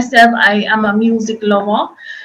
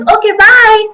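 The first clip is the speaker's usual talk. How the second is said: high, loud, sing-out catchphrase; TikTok lady's sign-off; 11 semitones higher, 5 dB louder than usual. Retold or imitated. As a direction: mimic a viral voiceover goodbye, pitched way up. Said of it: "she says".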